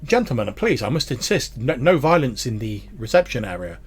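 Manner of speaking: ordinary delivery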